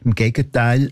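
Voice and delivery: normal talking voice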